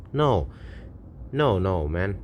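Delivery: usual speaking voice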